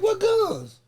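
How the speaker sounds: high, wide-eyed fake innocence; mock innocent. Cop asks about guns, high fake-innocent reply. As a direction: play dumb, pitch way up.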